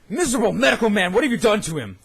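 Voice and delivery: indignant challenge. Acted. Hero challenging doctor.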